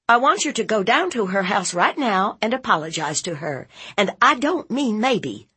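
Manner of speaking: high, brisk order; commanding aunt. Acted. Aunt ordering nephew to apologize, stern and quick. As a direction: issue orders that allow no argument.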